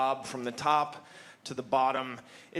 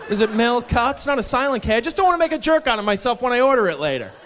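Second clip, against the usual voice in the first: higher, loud, puzzled questioning; clueless customer; 6 semitones higher, 8 dB louder than usual. Acted. Someone who never heard of milk asking about it, mock-puzzled. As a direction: stammer into an earnest mock inquiry. Imagined person unaware of milk.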